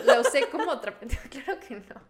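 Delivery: laughing. Real, not acted. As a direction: say it while cracking up. Jokes, laughing.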